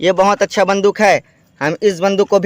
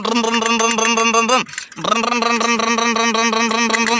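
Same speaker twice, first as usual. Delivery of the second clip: engine noises; much faster than usual. Pretend. Driving toy tractor into JCB.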